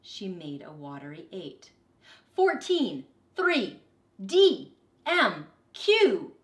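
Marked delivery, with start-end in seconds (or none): 2.4-6.4 loud, high rapid-fire callouts; teacher shouting letters and numbers; shouted. A narrator's reading. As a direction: fire off each one like a game caller. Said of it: "he shouted out".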